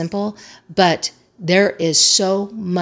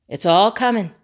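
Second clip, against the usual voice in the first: usual speaking voice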